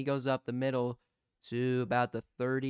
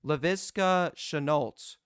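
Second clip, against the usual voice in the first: normal talking voice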